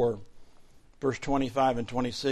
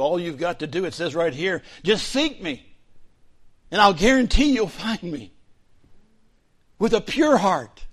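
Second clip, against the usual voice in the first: rising preaching; 7 semitones higher, 9 dB louder than usual. Real, not acted. Pastor urging the congregation to seek God, building in force. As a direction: swell up, promising them God will be found.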